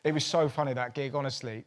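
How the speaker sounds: usual speaking voice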